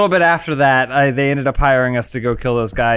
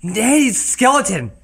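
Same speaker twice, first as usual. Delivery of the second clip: stretched, raised-pitch storytelling; rambling storyteller; 9 semitones higher than usual. Acted. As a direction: act out your own tale grandly. Montage of the endless tale.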